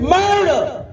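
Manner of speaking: high, loud cry of murder; screaming murder. Retold or imitated. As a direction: cry it out like a mother who lost her son. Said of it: "she's screaming".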